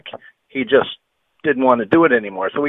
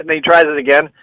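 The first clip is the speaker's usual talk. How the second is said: normal talking voice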